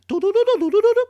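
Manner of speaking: high sung phone beeps; dial tone beeps. Acted. Narrator imitating the phone line ringing, high beeps.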